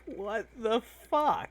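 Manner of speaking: incredulous. Acted. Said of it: "Doc M just looks to Edwin, like".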